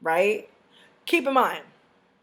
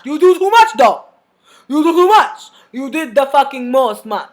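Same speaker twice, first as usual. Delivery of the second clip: fed-up shout; 2 semitones higher, 10 dB louder than usual. Retold or imitated. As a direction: let it burst out in exasperation. Telling off the clients.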